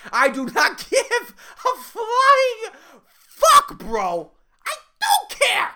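very high, strained screeching; hysterical rant. Acted. Underpaid barista ranting at singing customer, hysterical screech. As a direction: build to a slow, shrieked outburst.

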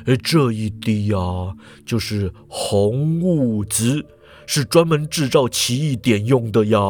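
put-on low, slow old professor; professor's voice. A narrator's reading. Professor shows the potion to the group, low and slow. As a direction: present it proudly, low and slow. Professor presents red drop.

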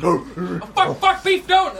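panicked yell. Acted. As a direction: shout after the runaway bear. Acted panic at bear.

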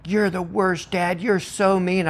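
a hint of a sulky child; child sulking at dad after climbing down, mildly huffy; huffy kid. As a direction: pout it out like a cross kid. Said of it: "huffing and like".

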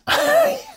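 very high squealed laugh. Real, not acted. Reacting to the listener's disbelief at the failed sale, laughing.

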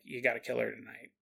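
low, soft sinister demon voice; menacing demon. Pretend. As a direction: deliver the order coldly. Demon ordering the murder.

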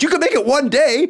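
high coaxing friend voice, pushing him to stay. Retold or imitated. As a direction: roommate urging him on the phone, high coaxing voice. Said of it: "He goes".

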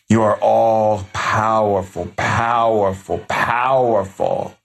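slow chanted blessing, powerful repeated; each powerful drawn out slowly; slow incantation. Acted. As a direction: intone it like a spell over the listener. Shaman blessing viewer by repetition.

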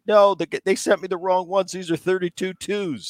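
high-pitched, self-mocking complaint; mock fretting. Hitter fussing over wrongly weighted bats, mock upset. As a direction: act out being obsessed with a tiny error.

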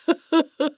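happy laugh. Retold or imitated. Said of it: "He's even laughing from it".

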